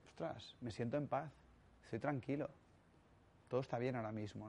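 soft, hushed words of inner peace; a serene master, softly at rest; calm at peace. Retold or imitated.

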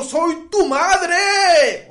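furious shout. Retold or imitated. Mother shouts at her son Ismael, a slow, high, loud shout. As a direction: blast it like a fed-up mother.

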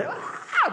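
very high cry of joy; joyful scream. Retold or imitated. Owner reliving the win, high joyful yelp. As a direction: let out a victory yelp. Horse wins the race.

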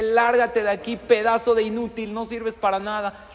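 loud, high shouted insults; acted yelling boss. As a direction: blast the insults at the candidate. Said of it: "te empieza a insultar y te dice".

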